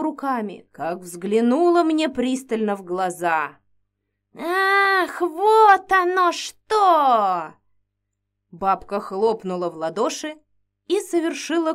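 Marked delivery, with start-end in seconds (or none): 4.4-7.5 high loud slow gasp of discovery; dramatic realization. Acted. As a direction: ham up the witch's discovery. Witch suddenly realizes.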